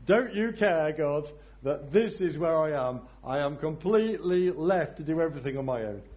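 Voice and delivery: high, loud pleading whine; pleading complaint. Acted. Martha pleading with God to notice her, high and loud. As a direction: beg God to notice your burden.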